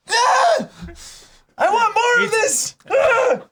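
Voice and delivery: shrieking high and loud with delight; fangirl scream. Real, not acted. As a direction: scream it out, overwhelmed by cuteness. Fangirling over cute couple scene.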